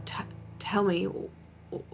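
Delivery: stammered whisper; coaxing whisper. Acted. Ash gently pressing Argyle for answers, whispered. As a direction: lean in and coax him softly. Coaxing him quietly in dream.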